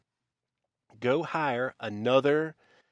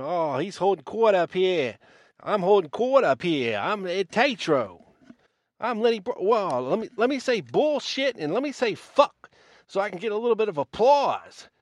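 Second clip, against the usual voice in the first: put-on loud, swooping Yankee-accent send-up of a stand-up act; mock Yankee accent; 4 semitones higher, 5 dB louder than usual. Mocking a conference speaker playing Lenny Bruce for applause, hammed-up Yankee accent. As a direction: ham up a Yankee comedian working the crowd. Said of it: "with your Yankee accent".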